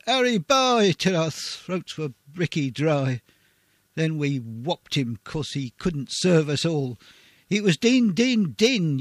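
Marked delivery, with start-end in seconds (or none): 0.1-0.9 high, loud soldier's shout. Acted. Troops shouting for the water-carrier. 7.5-9.0 loud, high refrain; calling out. Acted. Refrain shouted as the soldiers' call.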